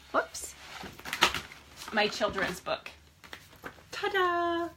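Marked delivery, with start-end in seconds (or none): 3.9-4.7 high, bright showman's ta-da; ta-da reveal. Real, not acted. Author unveiling her children's book to viewers, excited ta-da. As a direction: present it like a magic trick.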